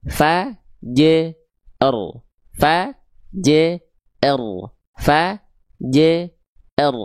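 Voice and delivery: "Fa, j, r" repeated in separate pieces; dipenggal-penggal. Shown as a demonstration. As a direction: leave a gap after each of "Fa, j, r". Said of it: "kita penggal-penggal dulu bacanya". ("Fa, j, r" dibaca terpenggal satu-satu)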